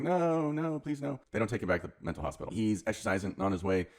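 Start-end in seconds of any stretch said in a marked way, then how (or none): 0.0-1.2 high, whiny mock plea of no, no; imitating a panicked plea; mock pleading. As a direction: beg not to go back, hammed-up. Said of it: "Pat's like".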